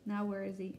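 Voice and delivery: ordinary delivery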